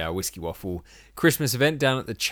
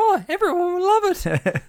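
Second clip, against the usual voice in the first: elf voice; 4 dB louder than usual. Acted. Mock elf voice reply.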